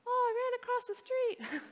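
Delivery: put-on high, innocent child's voice; cheerful child confession; kid voice. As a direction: say it like a guileless little kid.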